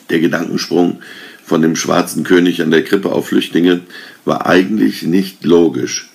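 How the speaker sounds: usual speaking voice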